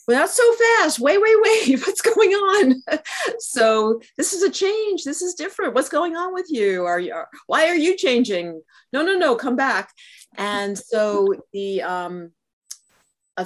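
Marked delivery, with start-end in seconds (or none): 0.1-9.9 panicky inner voice. Retold or imitated. [name] voicing her anxious mind in the sharing circle, high fretful voice. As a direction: play the mind flustered by fast change. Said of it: "my mind is, I think, sometimes".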